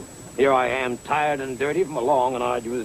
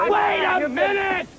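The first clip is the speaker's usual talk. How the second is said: high protesting call, held out; slower than usual. Acted. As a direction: hold on, shouted after him. Acted quarrel, calling after.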